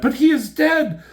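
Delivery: high, loud, frantic declaiming; carried-away melodrama. Acted. Reader voicing Elektra before the boatman, carried away in melodrama. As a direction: go overboard, building to an indignant cry. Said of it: "I'm getting a little carried away".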